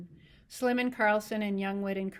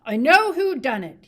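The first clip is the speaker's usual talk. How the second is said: much louder, high cry; 6 semitones higher, 16 dB louder than usual. A narrator's reading. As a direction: shout the accusation. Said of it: "he cried".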